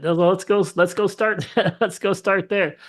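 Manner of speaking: eager to start right there. Retold or imitated.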